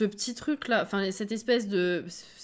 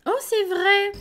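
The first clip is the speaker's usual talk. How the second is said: higher and louder sudden realization; oh, just remembered it; surprised; 10 semitones higher, 7 dB louder, slower than usual. Real, not acted. (surprise soudaine)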